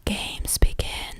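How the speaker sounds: slow, breathless sick whisper; gravelly whisper. A narrator's reading. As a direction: rasp it like a dying man. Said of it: "the voice went on".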